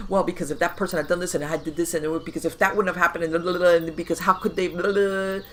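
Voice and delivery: loud, rapid-fire mock rambling; racing, loud run-on babble; frantic over-analyzing. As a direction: rattle it off like a mind spinning in circles. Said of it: "you know, you analyze".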